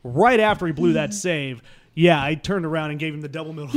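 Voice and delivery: ordinary delivery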